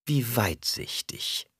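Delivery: slow, drawn-out sarcasm; mocking. A narrator's reading. Jessica mocks Alia's guard, slow and sarcastic. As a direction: lay the scorn on thick. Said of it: "sagte Jessica spöttisch".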